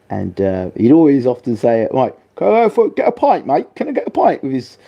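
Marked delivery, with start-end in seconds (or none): 1.9-4.4 high-pitched mock British accent; hammed-up British accent, pitched way up. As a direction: put on a squeaky imitation British voice. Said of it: "his British accent".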